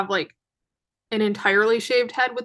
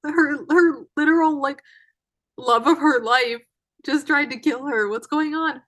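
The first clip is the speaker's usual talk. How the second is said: higher-pitched mock disbelief; incredulous viewer; 5 semitones higher than usual. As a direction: react like a baffled first-time viewer. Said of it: "for anyone who didn't read the book to be like".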